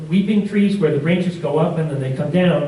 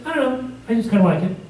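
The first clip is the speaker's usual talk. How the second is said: homeowner's shrug; 7 semitones higher, faster than usual. Retold or imitated. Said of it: "And she said".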